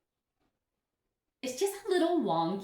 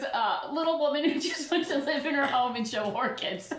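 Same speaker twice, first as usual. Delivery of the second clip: laughing; 6 semitones higher than usual. Real, not acted. Laughing while retelling insult.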